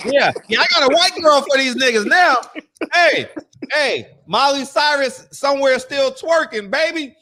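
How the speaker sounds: loud, high hype-man yelling; hyped shout. Acted. Friends on a podcast, hyping up a [name] joke loudly.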